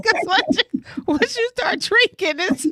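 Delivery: a teasing, high, put-on voice for the ex; lightly mocking the ex's warning; mock ex-boyfriend voice. Quoting her ex's advice.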